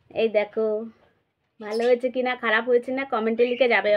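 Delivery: normal talking voice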